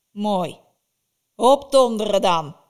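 very loud witch bark; gruff dismissal. Acted. Baba Yaga loudly dismisses Vasilisa, barking. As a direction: send her off harshly.

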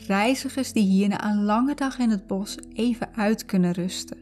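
ordinary talk, nothing marked

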